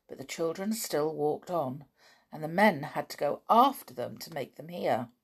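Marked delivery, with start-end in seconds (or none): none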